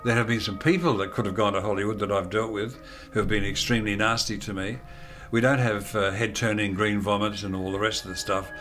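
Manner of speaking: ordinary talk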